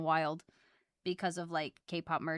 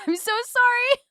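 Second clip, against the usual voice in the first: high, loud laughing sorry; giggly loud apology to chat; 12 dB louder than usual. Real, not acted.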